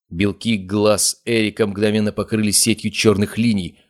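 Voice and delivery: usual speaking voice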